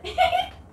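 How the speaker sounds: short high-pitched giggle. Real, not acted. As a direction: burst into a squeaky giggle. Laughing at the glasses.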